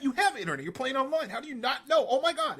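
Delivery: high, loud, laughing fan voice; mock a shocked fan yelling; hammed-up fan disbelief, yelled; imitating a fan. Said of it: "And they're like".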